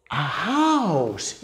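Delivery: stretched, swooping "house"; drawn-out picture-book reveal; storyteller's wonder. Shown as a demonstration. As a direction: marvel slowly at the picture for a child. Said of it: "Y entonces dices".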